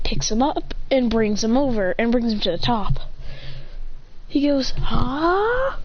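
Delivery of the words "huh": high rising questioning huh; puzzled huh. A narrator's reading. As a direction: react baffled, sliding upward. Acted puzzlement.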